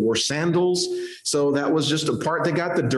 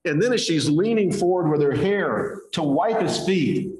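ordinary talk

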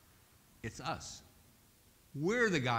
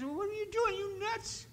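much higher, incredulous squeak; stunned disbelief. Acted. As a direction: react as if your friend just lost his mind. Stuck man stunned at friend.

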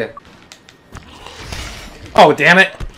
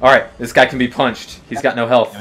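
normal talking voice

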